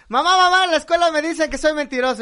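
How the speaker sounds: put-on high, loud, fast whiny child; little boy running to his mom, high and fast; kid voice.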